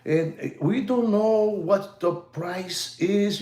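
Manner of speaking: lightly mocking a calm TV-style host; imitating his voice. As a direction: mimic a hushed, measured presenter voice. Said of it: "he talks like that".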